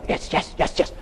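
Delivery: whispered rapid-fire hype chant; jazzing himself up. Retold or imitated. Nervous jumper shaking his leg, whispering himself up. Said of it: "jazzing myself up".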